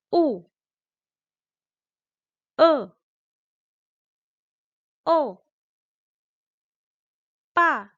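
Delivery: each of "Ma, i, u, e, o, pa, kan" falling sharply from high; "Ma, i, u, e, o, pa, kan" as separate loud, high drops; fourth tone, like someone angry. Shown as a demonstration. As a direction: throw each syllable "Ma, i, u, e, o, pa, kan" down like an angry command. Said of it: "seperti kayak orang marah gitu".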